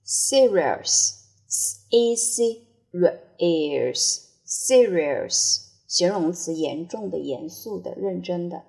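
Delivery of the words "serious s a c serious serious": slow, spelled and repeated serious; slow drill. Shown as a demonstration. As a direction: drag serious out for learners. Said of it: "slow teacher drill". (慢速领读 serious)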